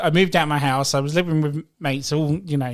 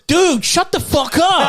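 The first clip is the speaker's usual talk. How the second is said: put-on high, loud, drawn-out kid's yell; shouting young kid; 12 semitones higher, 6 dB louder, slower than usual. Kid cutting off a heartbroken player, high mock shout. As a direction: imitate a child gamer yelling over the mic. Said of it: "nine years, ten years old".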